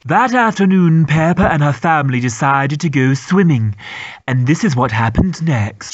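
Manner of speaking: storybook narrator. Acted. Narrator introducing the family's swimming trip, storybook voice. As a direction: announce the next scene like a kids' show.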